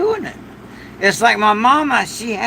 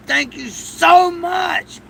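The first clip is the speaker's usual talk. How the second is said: heartfelt thanks; 8 semitones higher, slower than usual. Real, not acted. Slow grateful thanks, raised.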